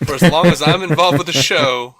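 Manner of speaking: loud, stressing long; hammed-up defiant quote; emphatic mock read. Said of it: "that sounds like he's begging to be fired".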